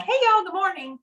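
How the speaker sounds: high, bright mock hello to coworkers; imitating a cheerful break room hello; cheery office greeting. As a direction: sing out a morning greeting. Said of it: "being like".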